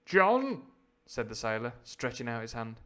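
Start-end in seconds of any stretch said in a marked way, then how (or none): 0.0-0.6 high, loud appeal; acted sailor's hail; calling out. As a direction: call his name out sharply. Sailor calls to Silver.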